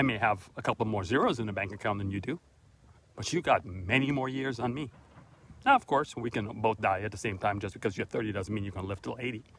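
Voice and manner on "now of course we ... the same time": ordinary talk, nothing marked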